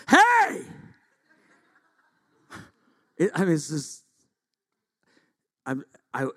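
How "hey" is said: high, very loud barked call; comic outburst at full volume; sudden shout. Acted. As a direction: explode out of gentleness. Comic outburst breaking promise of gentleness.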